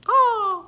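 loud high parrot squawk; shrill-pitched bird cry, very loud; parrot caw. A narrator's reading. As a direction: let out a piercing caw.

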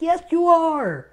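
high, slow singsong taunt; mock-teasing retort. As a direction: needle him, drawn-out and high. Teasing dad about being scared.

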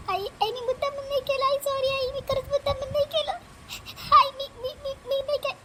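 high, tearful plea to her mother; a scared girl sobbing out her innocence; tearful pleading. Acted. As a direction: beg through tears, voice up high.

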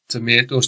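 "Tomatoes" with an AY in the middle, tuh-MAY-toes; American pronunciation. Shown as a demonstration. Teacher showing pupils how Americans say words, "Tomatoes" as tuh-MAY-toes. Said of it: "Americans do pronounce certain words slightly differently".

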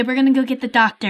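ordinary talk, nothing marked

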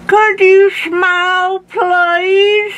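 put-on very high, loud old man voice; slurred high-pitched request; suspicious toothless Grandpa. A narrator's reading. Toothless Grandpa inspects officer's teeth.

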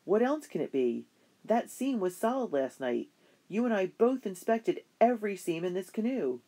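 father arguing with Yellow Wing, growing angry; angry insistence. Acted. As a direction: push back hard, building in heat. Angry father over sabotage.

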